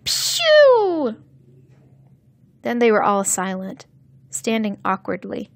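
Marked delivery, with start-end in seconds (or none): 0.1-1.2 high, loud pretend gunshot; long falling firing sound; child's make-believe rifle blast; imitated rifle noise. A narrator's reading. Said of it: "with a firing noise".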